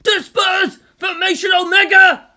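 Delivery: high, loud barked commands; frantic orders. Acted. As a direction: shout the orders in panic. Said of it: "The tacnet rang with frantic orders".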